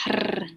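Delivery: "hr" with the R trilled long; rolled R. Shown as a demonstration. Teacher showing students the Gurmukhi R, rolled. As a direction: roll the R in "hr" on the tongue tip. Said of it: "you're rolling that".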